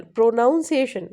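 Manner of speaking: "प्रोनाउंसिएशन" with a full "नाउन" in the middle; mispronunciation with "noun". Shown as a demonstration. Teacher giving a common wrong example, mispronounced with "नाउन". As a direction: say "प्रोनाउंसिएशन" loudly, as if built on "pronounce". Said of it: "प्रोनाउंसिएशन नहीं है वो".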